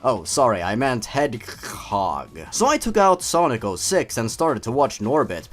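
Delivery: ordinary talk, nothing marked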